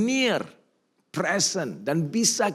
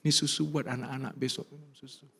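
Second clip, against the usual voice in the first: crying husband; 7 dB quieter than usual. Retold or imitated. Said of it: "saya nangis".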